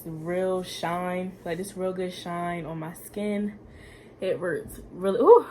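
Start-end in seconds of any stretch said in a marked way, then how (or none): none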